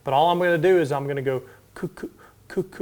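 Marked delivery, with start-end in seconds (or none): 1.7-2.6 soft separate k pops; slow feed clicks. Shown as a demonstration. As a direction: pop each k slowly. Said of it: "I'm gonna start off slow".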